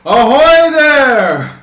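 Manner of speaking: drawn-out hail across the water; slow, loud call to a ship. Acted.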